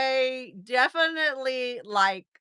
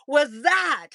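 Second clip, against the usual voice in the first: loud, startled retold demand; loud outcry; 3 semitones higher, 5 dB louder than usual. Patient asks therapist about the strange sensation, loud demand. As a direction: raise the voice sharply on the question. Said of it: "I said it, like, loud".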